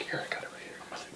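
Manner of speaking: hushed ring whisper; whispered aside between wrestlers; mock whisper. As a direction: whisper it, barely there. Retelling a wrestling spot.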